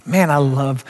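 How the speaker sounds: normal talking voice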